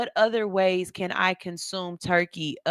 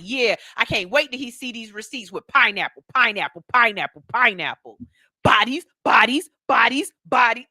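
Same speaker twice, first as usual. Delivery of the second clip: higher, louder gleeful repetition; happy, retold excitement; 5 semitones higher, 5 dB louder than usual. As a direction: act out giddy delight, hammering the repeated words. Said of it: "I was happy. I was like".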